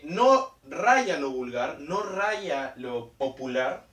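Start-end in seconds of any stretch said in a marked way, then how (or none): none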